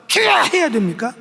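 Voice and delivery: loud crying out. Shown as a demonstration. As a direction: cry out to the Lord as if in a crowd. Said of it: "사람 많은데 꼭 그렇게 막".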